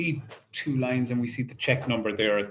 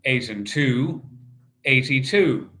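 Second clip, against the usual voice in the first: bingo caller; 4 semitones higher than usual. Acted. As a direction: call the numbers like a bingo hall host. Calling bingo numbers in rhythm.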